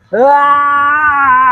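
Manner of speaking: loud high groan; loud pained groan. Acted. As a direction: cry out in pain. Possessed man cries out.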